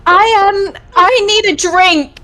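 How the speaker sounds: half-acted rush to escape; quoted tipsy woman. Recapping the party guest pleading for a drink, lightly voiced. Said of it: "She's like".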